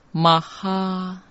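"玛哈" said ma-ha, drawn out on ha; pronounced maha. Shown as a demonstration. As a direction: say "玛哈" as maha, not the Mandarin 摩诃. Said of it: "摩诃两个字".

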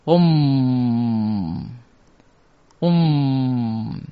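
"嗡" as om with a long hummed m; closed-mouth om. Shown as a demonstration. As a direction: close the lips on "嗡" and let it hum. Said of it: "嘴巴要闭起来".

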